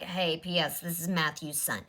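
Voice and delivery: hushed aside. Retold or imitated. Imagined aside from casting director.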